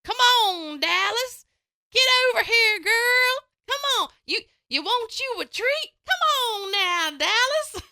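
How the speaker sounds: high, loud mock southern dog call; deep southern accent. Host pretends to call her dog Dallas, deep southern accent. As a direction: put on a southern twang and coax the dog. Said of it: "a very deep southern accent".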